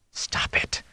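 hissed whisper to himself; self-scolding whisper. A narrator's reading. Rand scolds his own fear, in a whisper. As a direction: whisper it sharply under your breath. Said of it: "Rand scolds himself, whispered".

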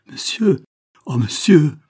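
high, loud pleading outcry; anguished cry. A narrator's reading. Mother falls to her knees before the doctor, crying out. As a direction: cry out sharply, begging for mercy. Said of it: "Madame Barton jeta un cri".

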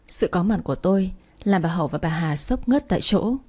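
ordinary delivery, nothing marked

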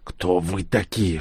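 a hint of a hoarse, beaten prisoner; croaked. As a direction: rasp it out weakly. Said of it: "и прохрипел".

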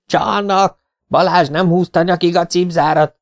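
high, outraged tattling cry; shrieked accusation. A narrator's reading. Team member accuses rival of cheating, shrieking. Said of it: "süvöltött Görgényi az ellenfelet figyelve".

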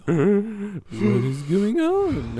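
high, loud, slow alarmed cry; startled gasp. Retold or imitated. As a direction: gasp then panic at the hole. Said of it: "And they're like".

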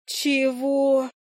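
high drawn-out astonished question; stunned. A narrator's reading. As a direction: let the disbelief jump up in pitch. Heroine stunned by marriage remark. (изумлённо, высоким голосом)

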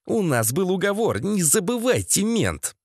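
restrained, prickly threat; through gritted teeth. A narrator's reading. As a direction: squeeze the reminder out resentfully. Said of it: "процедил Валера".

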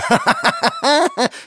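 very high manic cackle; clownish ghostly laugh. A narrator's reading. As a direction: cackle shrilly like a deranged clown. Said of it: "И снова клоунский смех призрачного силуэта".

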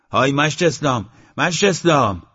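high, loud repeated hail; loud shout. A narrator's reading. Man alone on the porch calls Eslam, shouting. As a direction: call out across an open yard. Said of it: "با صدای بلند داد زد".

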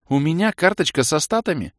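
high astonished cry; surprised exclaim. Acted. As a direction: gasp out the question. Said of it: "удивлённо воскликнула Кэлен". (удивлённое восклицание)

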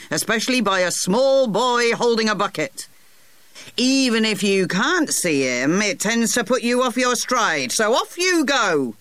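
put-on a fussy old gardener, pitched well up. Old gardener politely excusing himself to the boy, high put-on voice.

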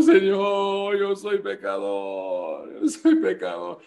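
high loud wailing sinner's plea, mocking; mock sinner crying out to the Lord; mock repentant sinner. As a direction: ham up a sinner begging the Lord.

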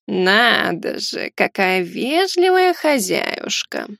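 very slow, drawling cat voice; snorting shadow cat. Acted. Ghost cat sizing up the hostess, snorting drawl. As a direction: drawl it with swooping mockery. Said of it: "фыркнула тень".

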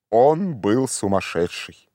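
ordinary delivery

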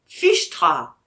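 mild exclamation; short surprised exclamation. Acted. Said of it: "s'écria Michel". (petite exclamation)

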